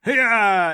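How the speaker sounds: loud, high cry of exertion; effort yell. Acted. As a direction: yell while swinging a heavy hammer. Effort cry swinging the hammer.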